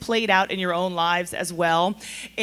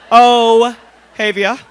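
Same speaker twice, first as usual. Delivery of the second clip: Ohavia drawn out slowly and much louder, laughing; laughing name call; 10 dB louder, much slower than usual. Real, not acted. Host calls out Ohavia's name to the room, laughing.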